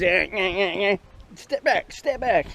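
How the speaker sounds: high, jerky twitching voice; twitchy coworker impression.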